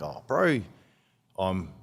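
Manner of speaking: put-on Māori New Zealander voice, lifting at the end; New Zealand Māori accent. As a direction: slide into an imitated Kiwi Māori accent. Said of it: "I learned how to speak like them".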